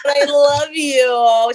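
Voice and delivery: high, slow, fake-weepy plea; mock-tearful mom. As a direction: pretend to tear up for the crowd. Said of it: "I'm like".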